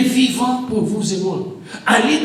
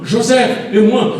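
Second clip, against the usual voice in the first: ordinary talk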